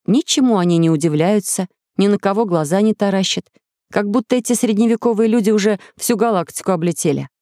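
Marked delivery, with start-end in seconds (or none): none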